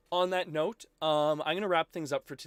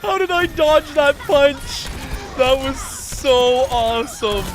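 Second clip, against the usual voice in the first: loud, high, drawn-out elation; elated amazement; 10 semitones higher, 9 dB louder, slower than usual. Real, not acted. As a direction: marvel loudly at your own lucky play. Celebrates a lucky dodge.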